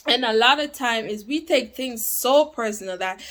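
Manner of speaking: normal talking voice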